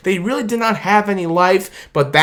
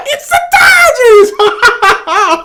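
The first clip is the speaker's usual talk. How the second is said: much higher, laughing cheer; laughing shout; 6 dB louder, slower than usual. Real, not acted. Fan celebrating back-to-back titles: high laughing cheer. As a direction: crack up while yelling it out.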